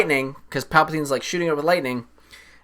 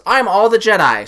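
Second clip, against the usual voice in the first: heroic declaration; 7 semitones higher, 6 dB louder than usual. Retold or imitated. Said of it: "she says".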